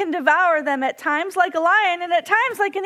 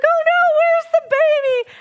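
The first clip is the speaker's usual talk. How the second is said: much higher, louder frightened outcry; panicked cry; 12 semitones higher, 6 dB louder than usual. Retold or imitated. Fearing the eagle took the baby, a loud panicked cry. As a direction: cry out in alarm for the chick. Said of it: "we're just like".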